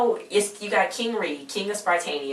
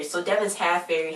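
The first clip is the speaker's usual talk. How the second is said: usual speaking voice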